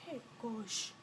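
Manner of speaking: very soft, low groan of dread; dread under breath. Retold or imitated. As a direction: shrink it to almost nothing. Dread at being told take it off.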